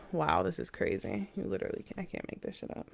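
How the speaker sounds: hushed stunned whisper. Real, not acted. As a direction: breathe it out in disbelief. Stunned by the card pulled.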